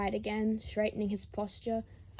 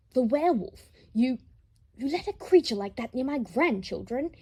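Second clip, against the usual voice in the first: raised, louder and higher, appalled; outraged shout; 3 semitones higher, 6 dB louder than usual. Acted. Lucius appalled, shouting at the blue-haired boy. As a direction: bark it out in horror at the werewolf.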